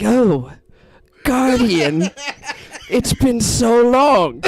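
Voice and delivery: hammed-up spirit. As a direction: play along with the old rival, overacting. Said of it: "with the Kalo impression".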